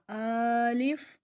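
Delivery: الف held long and slow, a clean open a; drawn-out alif. Shown as a demonstration. Tajweed teacher demonstrating الف slowly. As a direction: stretch الف slowly with a pure open vowel. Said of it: "الف ہے تو الف ہی ہوگا".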